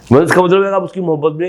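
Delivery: usual speaking voice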